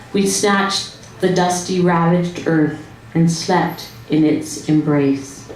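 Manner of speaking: normal talking voice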